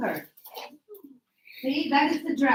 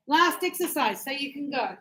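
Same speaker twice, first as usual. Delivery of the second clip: normal talking voice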